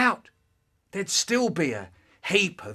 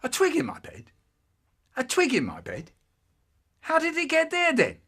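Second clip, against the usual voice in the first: higher, puzzled mock surprise; lightly voiced surprised brother; 9 semitones higher than usual. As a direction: act baffled at the news. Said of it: "So he goes".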